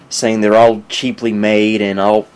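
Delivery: ordinary delivery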